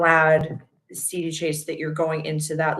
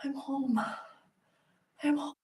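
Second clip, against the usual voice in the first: soft relieved sigh; 9 semitones higher, 10 dB quieter than usual. Retold or imitated. Relief arriving home.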